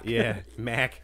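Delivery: put-on Boston accent. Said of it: "really thick accent".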